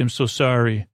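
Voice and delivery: ordinary talk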